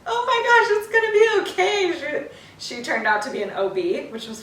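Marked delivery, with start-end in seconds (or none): 0.1-2.3 high, loud gushing reassurance; soothing nurse. Retold or imitated. The nurse calming a crying mother, high and loud. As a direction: coo over her like a kind nurse. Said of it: "she was like".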